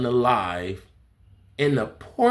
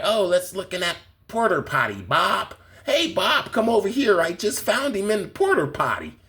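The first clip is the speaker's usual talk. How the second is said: high, goofy cop voice calling his partner; hammed-up officer shouting to Bob; mock cops; 7 semitones higher than usual. Mocking police finding him.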